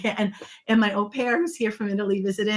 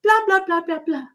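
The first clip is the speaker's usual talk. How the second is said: much higher, sing-song complaint; mock whiny complaining; 10 semitones higher than usual. Retelling her car rant to a friend, mock whiny. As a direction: ham up a petty rant. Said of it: "I was in the car complaining".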